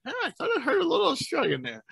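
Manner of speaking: tossing off a teasing aha to the guest; playful mock realization. Host replays his own hunch to the guest, quick and high-pitched. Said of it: "I was like".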